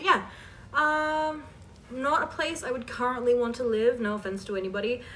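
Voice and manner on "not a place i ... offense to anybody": normal talking voice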